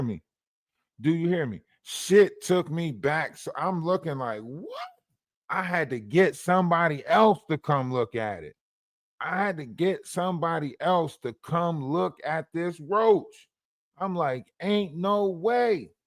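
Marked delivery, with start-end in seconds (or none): 4.4-4.9 tiny, very high squeak of disbelief; mock-stunned falsetto question; incredulous. 14.6-15.9 high, drawn-out mock disbelief; slow, incredulous refusal to believe it; disbelieving.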